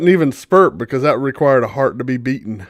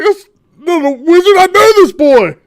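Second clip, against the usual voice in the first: put-on loud high "I know this boy"; anguished shout; 12 semitones higher, 8 dB louder than usual. Loud high anguished shout. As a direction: Harvick in shock over the dead boy. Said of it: "he's like".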